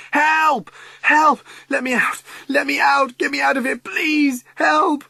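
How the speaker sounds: very high, loud screams for help; locked in alone, screaming terrified for help. Acted. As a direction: scream for your life. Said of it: "scream, scream".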